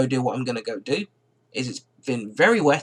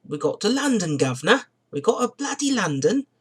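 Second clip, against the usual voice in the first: high-pitched put-on London accent; mock London accent; 7 semitones higher than usual. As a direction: do a hammed-up Cockney-style London accent. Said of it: "what the hell that accent was".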